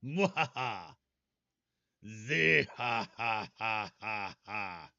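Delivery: slow evil mad scientist cackle; mad scientist laugh. Pretend. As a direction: cackle like a villain in a lab. Evil laugh after goofy goober.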